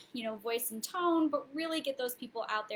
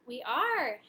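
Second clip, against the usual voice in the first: surprised; 7 semitones higher, 3 dB louder than usual. Real, not acted. Relieved to find stream live.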